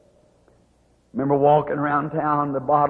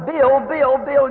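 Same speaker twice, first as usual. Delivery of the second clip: mock hollering; 8 semitones higher, 3 dB louder, much slower than usual. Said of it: "start hollering".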